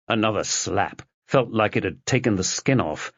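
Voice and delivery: usual speaking voice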